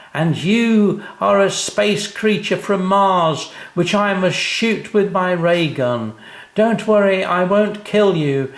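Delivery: high, loud spaceman declaring. A narrator's reading. Sam telling sister he'll stun her, spaceman voice. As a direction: grandly announce your mission.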